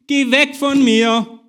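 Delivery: exasperated officer shouting at the clinging prisoner; commanding shout. A narrator's reading. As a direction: shove him off with your voice. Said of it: "befahl ich".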